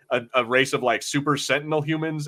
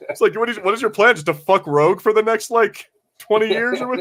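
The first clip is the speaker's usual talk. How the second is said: incredulous rant; 7 semitones higher, 4 dB louder than usual. Real, not acted. Incredulous rant about Magneto.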